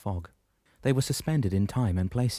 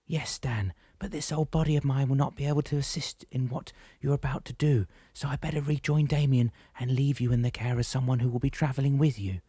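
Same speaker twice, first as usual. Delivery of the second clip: put-on higher, set-apart voice of a wise old woman; gentle oracle voice, pitched up; Solangi's voice; 6 semitones higher than usual. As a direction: speak as the calm old guide Solangi. Solangi speaks, voiced figure.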